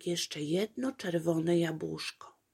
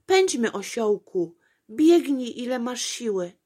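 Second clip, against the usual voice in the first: louder, higher eager urging; 5 semitones higher, 7 dB louder than usual. A narrator's reading. Apolejka urging the donkey after the apple, eager. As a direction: spur the donkey on.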